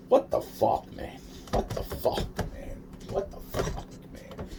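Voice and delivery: breathy disbelieving whisper, under the breath. Real, not acted. Baffled by the swan and woman story, whispering. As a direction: mutter it in awe, all air.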